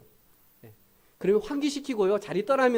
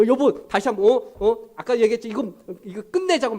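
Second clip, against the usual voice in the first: nagging wife; 5 semitones higher, 5 dB louder than usual. Retold or imitated. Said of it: "막 이렇게 하지 마세요".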